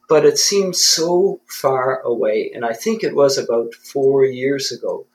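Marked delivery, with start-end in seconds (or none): none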